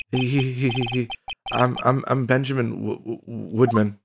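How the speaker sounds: soft, stammering timid performer; nervous ventriloquist. Acted. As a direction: stumble shyly over the words. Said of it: "This guy's so nervous".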